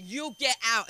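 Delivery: telling her off. Retold or imitated. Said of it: "I said".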